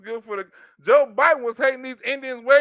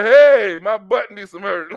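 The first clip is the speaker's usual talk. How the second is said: mock drunk Don; 2 semitones lower, 8 dB louder, slower than usual. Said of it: "Don Lemon drunk".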